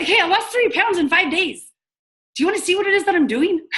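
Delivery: high, hammed-up sales enthusiasm; excited. Shown as a demonstration. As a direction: burst out with good news, quick and high. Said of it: "Be excited".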